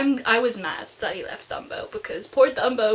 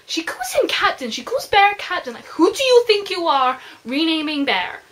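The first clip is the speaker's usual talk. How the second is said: higher, louder outraged complaint; indignant rant; 6 semitones higher, 6 dB louder than usual. Real, not acted. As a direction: scold the character, mock-outraged. Indignant rant about renaming Bear.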